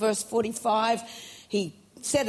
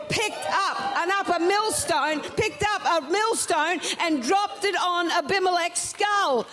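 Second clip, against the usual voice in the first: full-force preaching; 6 semitones higher than usual. Acted. Preacher to church about the certain woman, fervent preaching. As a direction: lift the congregation, press every line.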